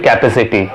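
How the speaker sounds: "capacity" as KAP-uh-sity; full "kap" opening "capacity"; wrong pronunciation. Shown as a demonstration. Teacher warns learners, "capacity" wrongly said KAP-uh-sity. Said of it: "So do not say".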